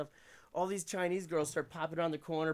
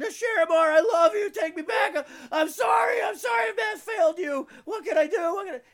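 put-on desperate drunken shouting at her window; drunk yelling; 15 dB louder than usual. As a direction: bellow it up at the window, begging.

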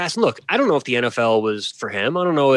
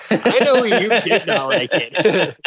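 words bubbling with laughter, slower; laughing while teasing; 6 semitones higher, slower than usual. Real, not acted. Teasing her co-host about the Brian Kelly bit, laughing. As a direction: tease him through a laugh.

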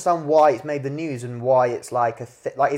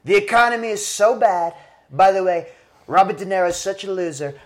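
imitating Trump; 4 semitones higher than usual. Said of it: "you go".

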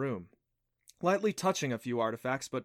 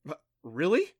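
stammered, louder surprise; startled; 4 semitones higher, 5 dB louder than usual. Acted. Adam reacts to the stones of prophecy, startled. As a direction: stumble into a disbelieving question.

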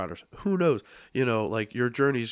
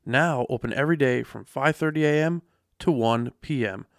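upbeat sponsor read, louder and higher; 3 semitones higher, 5 dB louder than usual. Acted. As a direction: read it like a radio ad.

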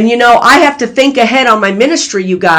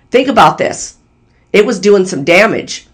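normal talking voice

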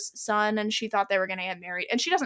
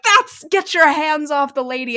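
louder, higher slowed-down protest; storming in; 5 semitones higher, 7 dB louder than usual. Retold or imitated. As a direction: barge in, outraged and heroic. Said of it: "storms in and is like".

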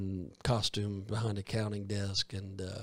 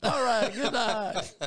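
loud cheery farewell; 12 semitones higher, 8 dB louder, slower than usual. Acted. Ending the show loudly.

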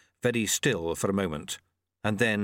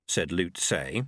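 usual speaking voice

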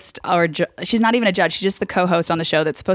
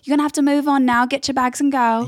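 high sing-out British dismissal; falsely kind send-off; petty British co-host; 7 semitones higher than usual. Acted. Co-host sending the loser home, petty. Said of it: "this bitch is so petty".

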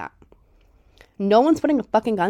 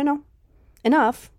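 ordinary delivery

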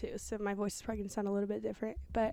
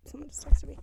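whisper; 4 semitones higher, 8 dB louder than usual. Real, not acted. Whispering so family won't hear.

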